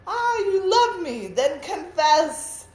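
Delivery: a lightly voiced turtle begging for love; lovesick turtle. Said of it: "Said the turtle to the bagpipe".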